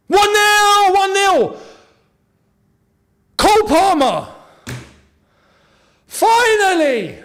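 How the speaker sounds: high, loud, elated yelling; goal celebration. Real, not acted. Fan watching his club score, ecstatic shouting. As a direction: scream it like your team finally scored.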